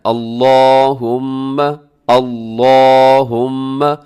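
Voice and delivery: अल्लाहुम्मा with heavy, rounded "law" and long hummed m; loud, slow, full-mouthed अल्लाहुम्मा; thick lam. Shown as a demonstration. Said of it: "आपने इसको मोटा पढ़ना है".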